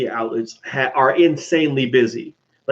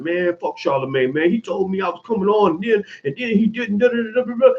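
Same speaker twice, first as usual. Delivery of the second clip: mock petty rant; 6 semitones higher than usual. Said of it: "be like".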